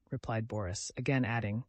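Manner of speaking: ordinary delivery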